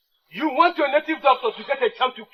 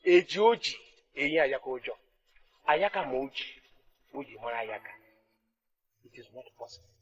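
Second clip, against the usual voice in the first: low proverb; 10 semitones lower, 7 dB quieter than usual. Acted. King recites proverb, low.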